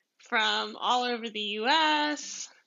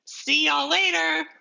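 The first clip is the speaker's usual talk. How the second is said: higher, upbeat goodbye, re-enacted; joyful farewell; 5 semitones higher, 4 dB louder than usual. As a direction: call a happy goodbye on your way out. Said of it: "just beside myself with joy".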